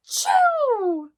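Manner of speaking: loud, high hammed-up achoo; storyteller's giant bear sneeze; big sneeze. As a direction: act out a huge sneeze.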